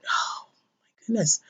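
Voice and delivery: slow whisper, fighting back tears; emotional whisper. Real, not acted. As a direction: let it slip out slowly in a whisper, moved. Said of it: "I'm just getting really emotional".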